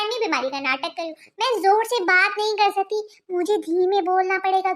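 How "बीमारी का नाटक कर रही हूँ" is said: mocking refrain. [name] mocks her husband's suspicion, low sarcastic drop. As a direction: drop much lower and softer, sarcastic.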